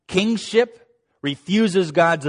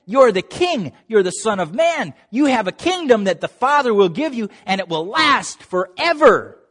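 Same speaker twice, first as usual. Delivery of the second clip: higher, louder pleading with Jesus; higher and louder, pleading this can't be; passionate protest; 6 semitones higher, 3 dB louder than usual. Retold or imitated. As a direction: plead with the master in disbelief. Peter passionately protesting, voiced.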